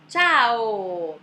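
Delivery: upbeat, drawn-out Italian ciao; very cheerful. Retold or imitated. Teacher imitating Italians greeting, very cheerful drawn-out ciao. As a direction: greet brightly, stretching it to the final O. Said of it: "bem alegre".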